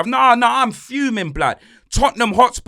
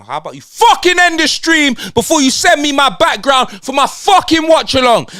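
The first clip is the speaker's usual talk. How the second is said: loud, higher furious telling-off; angry rant; 5 semitones higher, 4 dB louder than usual. Acted. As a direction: lose it at the producer. Raging at Dave for ending stream.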